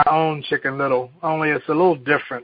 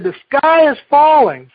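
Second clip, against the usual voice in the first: Ducky Lucky alarm; 10 semitones higher, 8 dB louder than usual. Acted. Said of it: "Ducky Lucky said".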